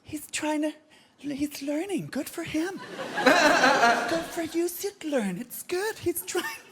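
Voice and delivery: high, cooing mock sympathy; sympathetic encouragement. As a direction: coo indulgently for a struggling beginner. Acting a sympathetic piano audience.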